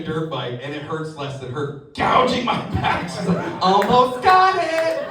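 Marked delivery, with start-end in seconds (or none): 3.6-5.1 slow, high focused wife; wife concentrating. Retold or imitated. Wife to husband while picking at his back, slow and high. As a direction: drag it out like she's absorbed.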